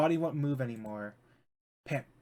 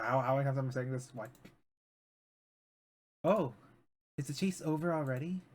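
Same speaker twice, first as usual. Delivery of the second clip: normal talking voice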